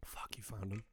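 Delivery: breathy whispered curse. Real, not acted. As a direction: hush it, mock caught out.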